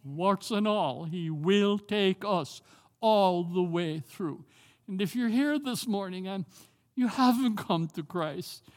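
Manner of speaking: normal talking voice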